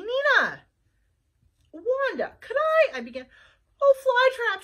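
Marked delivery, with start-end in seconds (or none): 1.7-3.0 a higher, eager question; Flytrap voice. Acted. Flytrap asking Wanda for help, eager. Detective asks. 3.8-4.6 high, loud cutting in; Wanda interrupting. Acted. Wanda interrupting Flytrap, urgent. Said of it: "she interrupted".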